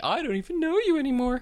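high, hammed-up dramatic outrage; high melodramatic hurt; over-the-top accusation. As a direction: overplay the betrayed friend. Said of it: "goes a little over the top".

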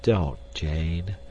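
subdued, gently pained plea; sad. A narrator's reading. Clayton stopping Jane's reproach, sadly. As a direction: ask her sadly to stop. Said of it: "said Clayton sadly".

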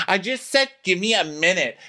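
high-pitched argumentative retort; mock fed-up squabbling; acted exasperation.

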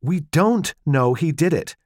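slow, pressed, stress on "don't"; "don't" hit hard and drawn out, falling; irritable. Acted. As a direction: cut him off, annoyed. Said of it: "she said irritably".

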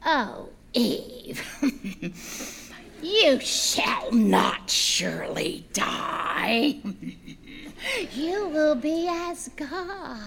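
very slow, drawn-out whispery tempter with chuckles; whispering serpent. Acted. Serpent luring Eve to eat, slow hissed whisper. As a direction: stretch each word out in a sly whisper.

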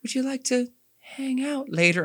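high, put-on flirty invitation; imitating game character. Mimicking Liara asking the player out, high put-on voice. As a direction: mimic her in a high little voice. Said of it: "She's like".

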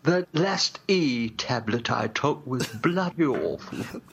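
slow, off-hand English drawl; a hint of a dissolute toff; lightly voiced Bum Cop. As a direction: complain slowly about the bad pill.

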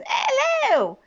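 high, drawn-out mock British hello; hammed-up awful British accent; bad British accent. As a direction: drunk Mary Poppins British squawk. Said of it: "like a drunk Mary Poppins".

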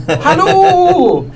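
high, drawn-out comic holler; calling out. Acted. Player calling from the window to a fleeing maid, high hammed-up holler. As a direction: holler after someone far away.

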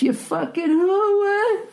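screeching falsetto yell, stretched slow; mock scream. Advising a friend, screamed at departing ex: high drawn-out mock screech. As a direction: screech it high like a cartoon fit. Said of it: "you just scream out".

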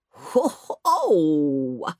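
big jolly polar bear laugh; jolly roaring bear. Acted. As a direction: roar it out merrily. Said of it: "roared Mister Whitewash in his jolly voice".

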